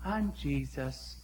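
soft, slow, spooky impression of a would-be Jesus; creepy Jesus impersonator. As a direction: hush it and slow it down like a man who thinks he's Jesus. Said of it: "he talked like this".

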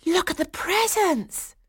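very high, slow, drawn-out squeal; excited echoing cry. A narrator's reading. Girls echoing the boys at the gifts, excited cry. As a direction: cry it high, stretching the words. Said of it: "the girls echoed them".

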